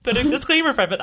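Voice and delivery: lightly voiced friend, laughing; quoting her friend. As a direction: toss off her line with a giggle. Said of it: "And then she said".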